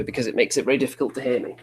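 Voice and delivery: ordinary delivery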